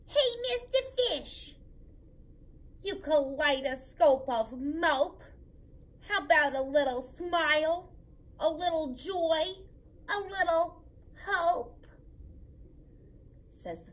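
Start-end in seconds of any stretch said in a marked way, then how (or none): 0.1-11.9 a slightly rude squirmy squid; cheeky squid. Acted. Squid teasing the mopey fish, loud and cheeky.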